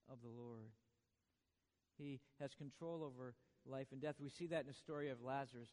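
ordinary talk, nothing marked

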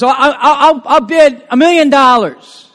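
mock eager bid with a laugh; hammed-up auction bid; eager bidder. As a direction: call out the bid like a keen buyer. Pastor acts bidder at auction.

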